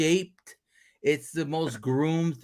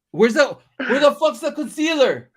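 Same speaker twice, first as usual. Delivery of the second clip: mock angry yell; 8 semitones higher, 4 dB louder than usual.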